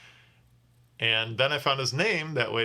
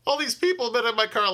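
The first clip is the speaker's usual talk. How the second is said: much higher, fast squealing disgust; grossed-out panic; 12 semitones higher, 5 dB louder, faster than usual. Retold or imitated. Driver realizing how many people rode in his car, squealing disgust. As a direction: shriek it as the germs dawn on you. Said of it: "I psychologically go weird".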